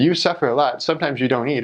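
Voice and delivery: ordinary delivery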